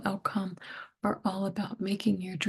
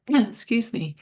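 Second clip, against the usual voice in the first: ordinary talk, nothing marked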